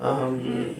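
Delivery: low, hushed "Amin"; soft, short low amen; quiet amen. Shown as a demonstration. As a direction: let "Amin" out softly, barely above a murmur. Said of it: "আস্তে বলছি এবার দেখেন".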